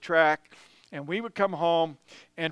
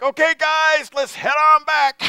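much higher and louder, a breezy rallying call; calling out to the group; 10 semitones higher, 9 dB louder than usual. Retold or imitated. Lead runners calling the tired group back out, loud and breezy. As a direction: call the whole pack to turn back.

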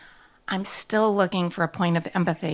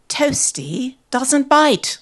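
put-on slow, higher British-accented denial; British owner's insistence; 7 semitones higher, 4 dB louder, much slower than usual. A narrator's reading. British owner contradicts the narrator.

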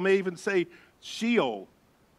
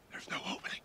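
very soft whisper; whispered worry; 16 dB quieter, faster than usual. Retold or imitated. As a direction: whisper to the guide in the dark. Searching in the silent dark.

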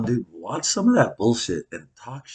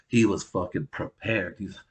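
ordinary delivery

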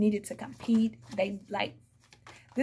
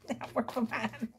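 sassy aside; 6 dB quieter than usual. Shown as a demonstration. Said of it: "How about that?".

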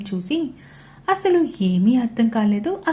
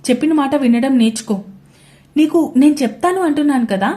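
barely controlled anger; 4 semitones higher, 6 dB louder than usual. A narrator's reading. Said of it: "శాంతంగా చెప్పడానికి try చేశాడు".

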